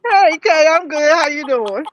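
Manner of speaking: talking through a mouthful of food; words round a bite, mouth full. Real, not acted. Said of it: "I don't wanna talk with my mouth full".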